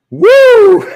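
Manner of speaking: loud, high-pitched woo; whoop. Real, not acted. Breaking off the coin explanation with a loud whoop. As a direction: let out a big high whoop.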